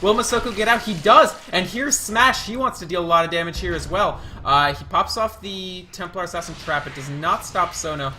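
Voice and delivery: quick-fire esports commentary, surging at key moments; live play-by-play. Real, not acted. Caster to viewers as a chase unfolds, excited play-by-play.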